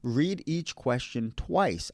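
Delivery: slow, with "twice" stressed and falling; dead serious emphasis. Real, not acted. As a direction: insist on "twice", taking your time. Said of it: "I am dead serious about this".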